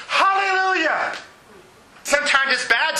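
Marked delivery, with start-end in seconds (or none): 0.1-1.1 high-pitched cry of praise; psalm's closing hallelujah. Retold or imitated. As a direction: call it out as a joyful ending. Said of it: "and it ends with".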